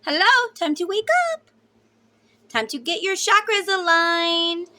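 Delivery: high, loud wake-up call; teasing high-pitched nag; emphatic scolding. Real, not acted. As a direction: shake him awake. Scolding the stubborn masculine.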